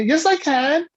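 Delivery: put-on high, defiant kid voice; defiant child. Speaker voices Johnny defying his parent, high and defiant. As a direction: talk back like a stubborn boy. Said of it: "And Johnny".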